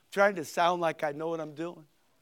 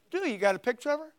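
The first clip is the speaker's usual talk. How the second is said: rapid, higher teasing question; eager question; 6 semitones higher, much faster than usual. Retold or imitated. Preacher imitates a teenage friend quickly asking about a girlfriend. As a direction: fire it off like a nosy buddy.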